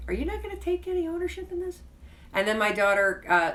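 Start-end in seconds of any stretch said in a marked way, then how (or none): 0.1-1.8 high, fast disbelief; incredulous inner voice. Retold or imitated. Mom silently reacting to her daughter's shrug, high and incredulous. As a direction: rattle it off in shocked disbelief, pitched way up. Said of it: "And I'm thinking".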